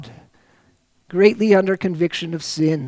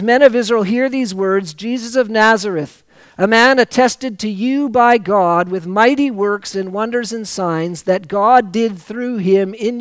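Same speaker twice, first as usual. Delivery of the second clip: rising preacher's proclamation, louder; preaching; 2 semitones higher, 4 dB louder than usual. Acted. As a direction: proclaim it like Peter to the crowd. Preacher reads Peter's sermon.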